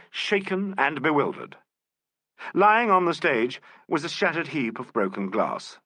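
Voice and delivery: ordinary delivery, nothing marked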